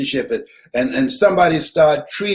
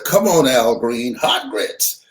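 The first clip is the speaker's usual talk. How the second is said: normal talking voice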